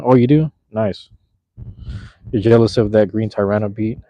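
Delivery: ordinary talk, nothing marked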